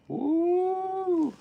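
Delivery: long, high whoop; pleased, drawn-out woo; excited woo. Real, not acted. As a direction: let out a sustained, high-pitched "woo". Pleased with the shirt held up.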